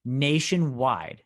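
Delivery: "nationwide." drawn out slowly and given full weight; stress on nationwide. Real, not acted. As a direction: stretch out "nationwide." slowly for emphasis. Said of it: "I also wanna emphasize the, the next word".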